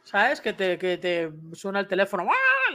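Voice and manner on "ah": sudden high gasp of fright; startled yelp. Real, not acted. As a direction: jump at the phone alarm. Startled by phone alarm. (grito corto de susto, muy agudo)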